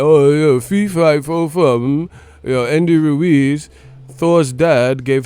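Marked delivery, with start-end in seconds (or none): 0.0-2.1 mocking Joshua. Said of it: "Joshua would just be saying".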